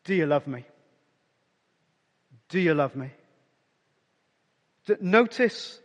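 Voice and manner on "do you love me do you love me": low, soft and tender; tender question. Retold or imitated. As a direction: ask it gently, searching the heart. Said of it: "he says to Peter".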